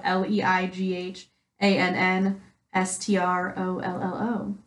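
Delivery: slowly letter by letter: L E I G H A N N S T R O L L O; spelling it out. Shown as a demonstration. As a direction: spell out L E I G H A N N S T R O L L O one letter at a time. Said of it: "at Leanne Strollo".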